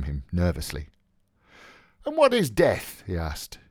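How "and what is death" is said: high-pitched rhetorical challenge; Buck Mulligan, challenging. Acted. Mulligan defending himself to Stephen, challenging. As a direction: throw the question out high and brisk.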